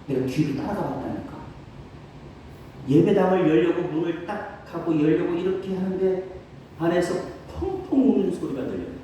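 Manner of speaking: usual speaking voice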